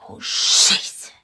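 breathy whispered dismay under her breath; whispered curse. Acted. Eva hears the gang is in the crowd, whispering a curse.

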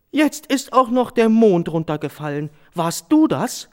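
a mother shocked at the fallen moon; startled mother. Acted. (erschrockene Mutter, viel höher)

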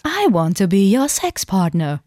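slow, stiff robot voice. Pretend. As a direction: speak like a humanoid robot. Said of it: "ich mach's mal mit dir".

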